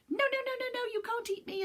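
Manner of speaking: high, squeaky, fast panicked little voice; a tiny cookie in a flap; high panicky little gingerbread man; frightened gingerbread man. Acted. Gingerbread Man refuses the fox.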